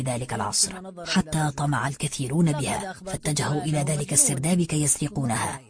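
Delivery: low, soft, even reading; subdued, measured manuscript voice; written account read out. Acted. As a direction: read like an old author's hushed confession. Reads out the book's text.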